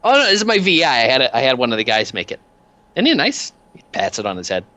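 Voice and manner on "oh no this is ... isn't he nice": high-pitched, pleased little enforcer voice; chummy thug showing off. Acted. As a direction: proudly show off a pet gadget, pitched well up. Peanuts shows off his robot.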